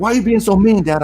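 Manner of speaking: put-on high, loud teenage whine; whiny daughter. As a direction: accuse him in a high wail. Daughter accusing dad.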